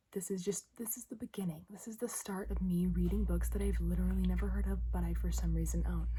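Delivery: talking with a retainer in; wearing a retainer. Real, not acted. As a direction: speak around a dental retainer. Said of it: "Also, I do have my retainer in".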